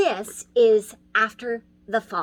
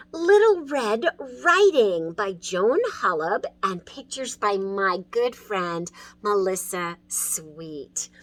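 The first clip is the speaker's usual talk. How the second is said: ordinary delivery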